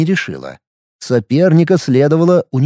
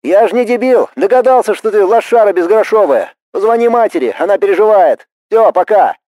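higher-pitched, exasperated telling-off; annoyed, raised voice; irritated scolding; 6 semitones higher than usual. A narrator's reading. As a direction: scold a hopeless brother, impatient and high. Annoyed brother scolding on the phone.

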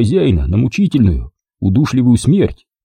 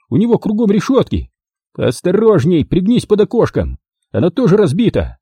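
high-pitched, pressing orders; urgent shouted instructions; 9 semitones higher than usual. A narrator's reading. Driver directs his helper to the hose, urgent shout. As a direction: keep calling orders, voice raised high.

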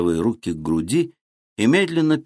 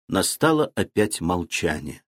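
usual speaking voice

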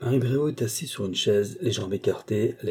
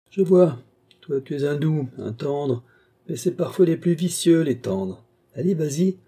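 higher, louder mocking dare; Marie, taunting; 4 semitones higher, 4 dB louder than usual. Woman daring the young man to strangle her, taunting. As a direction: goad him, offer your throat.

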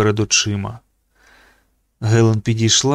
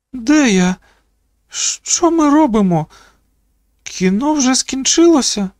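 very high, thin-pitched questions with pauses between; waking, confused girl; 13 semitones higher than usual. A narrator's reading. Dazed girl asking where she is, confused. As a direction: come round puzzled, in a high girlish voice. Dazed Iris suddenly comes round.

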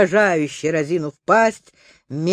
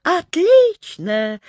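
high, slow squeak; squeaky alien; 8 semitones higher, much slower than usual. A narrator's reading. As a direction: squeak it out like a tiny robot. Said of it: "пропищал Клюк-Клюк". (писклявым голоском)

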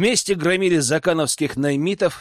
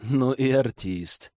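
slow quiet words from mouth corner; side of mouth; 3 semitones lower, 3 dB quieter, slower than usual. Acted. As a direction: keep it low, out the side of your mouth. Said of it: "процедил уголком рта".

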